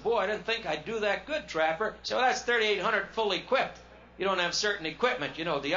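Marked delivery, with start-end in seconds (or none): 0.0-1.9 pleased surprise. Retold or imitated. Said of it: "I said".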